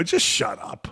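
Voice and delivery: whispered, dismissive hush; whispered brush-off to a friend; whispered shut up. Retold or imitated. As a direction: hiss it as a quiet put-down. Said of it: "I go".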